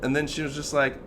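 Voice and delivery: ordinary talk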